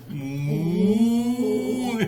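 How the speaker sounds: sung playful moo; cow moo. Pretend. Cow sound for toddler.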